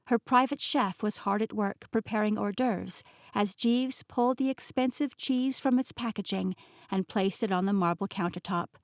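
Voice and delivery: usual speaking voice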